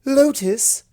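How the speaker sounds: high, loud call across the room. A narrator's reading. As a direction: call the desk pony by name. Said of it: "the unicorn called to the Blue Earth pony".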